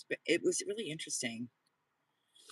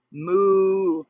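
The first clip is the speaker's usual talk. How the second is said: mooing like a cow, louder; 4 semitones higher, 12 dB louder than usual. Shown as a demonstration. Explaining the dog's nickname, imitating a loud cow moo. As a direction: belt out a moo. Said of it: "cows say moo?".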